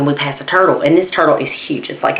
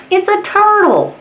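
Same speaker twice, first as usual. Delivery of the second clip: exasperated. Retold or imitated. Exasperated at friend.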